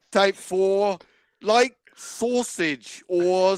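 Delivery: reading aloud in a hint of an Australian accent. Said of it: "Now do that in an Australian accent".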